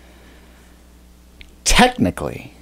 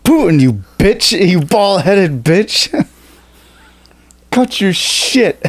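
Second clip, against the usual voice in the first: mocking voice; 8 semitones higher, 4 dB louder, slower than usual. Mock insult of Putin in voice.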